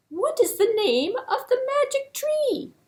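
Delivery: high, light animal voice; eland's high voice. Acted. Eland asking the king in a high voice. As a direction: ask it in a high little voice.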